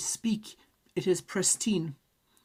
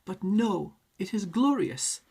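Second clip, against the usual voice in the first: ordinary talk, nothing marked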